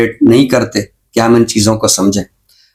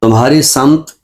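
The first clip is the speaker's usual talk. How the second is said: ghazal recitation. Acted. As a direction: savour each line of the couplet. Recites his ghazal on air. (غزل کا ٹھہرا ہوا ترنم سے پاک پڑھنا)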